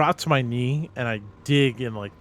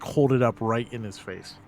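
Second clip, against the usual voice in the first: ordinary talk, nothing marked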